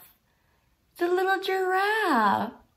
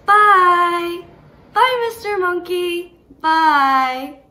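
monkey goodbye; 2 semitones higher, 8 dB louder, slower than usual. Acted. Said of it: "now he's saying bye".

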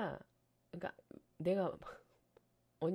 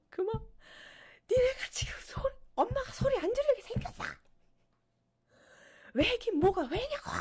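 high, loud imitated furious mother; hammed-up mom yelling at the kids; mock mother scolding, much higher and louder; angry mom; 4 dB louder than usual. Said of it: "엄마가 그렇게 화를 내는 거야".

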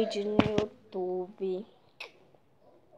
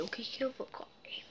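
whisper; 12 dB quieter than usual. Real, not acted. Wondering what to eat.

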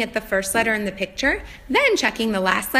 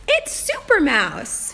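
put-on reading to a toddler; 3 semitones higher, slower than usual. Teacher reads the book title.

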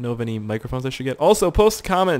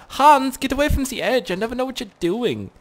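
calling to Hans; 11 semitones higher than usual. Pretend. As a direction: warn him off the ledge in a lightly voiced call. Scolding teammate at edge.

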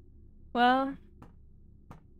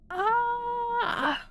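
startled gasp; 11 semitones higher than usual. Real, not acted. Startled by the creature.

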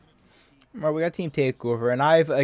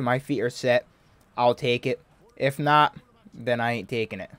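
ordinary delivery

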